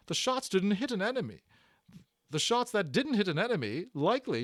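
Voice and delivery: high, loud scolding nurse voice; high-pitched, loud, indignant nurse; bossy little nurse. Acted. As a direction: scold like a fussy matron. The nurse answers Omri.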